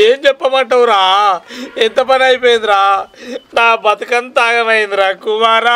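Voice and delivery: loud hammed-up crying lament; tearful wailing. Man telling his friend life is ruined, loud tearful wailing. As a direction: cry it out loud, dragging out the final words. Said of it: "ఆడదానిలోకి ఏడవకరా".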